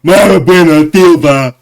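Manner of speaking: loud, slow, put-on deep voice; deep-voiced impression of Mayra. Teasing Mayra about how her voice sounds, loud slow deep mockery. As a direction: boom it out slowly in a mock deep voice. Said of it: "it's even an octave deeper than what I just did".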